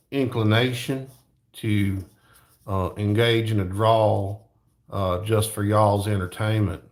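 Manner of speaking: laid-back Southern drawl; lazy cowboy drawl. Shown as a demonstration. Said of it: "that's my lazy cowboy voice".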